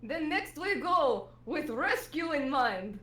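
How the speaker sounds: put-on slow, heroic Russian accent; rally the crew in slow Russian; Russian Garfield. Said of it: "cried out Garfield".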